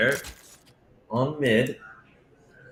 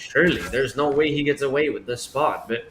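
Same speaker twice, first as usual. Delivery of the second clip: live play-by-play; 4 dB louder than usual. Acted.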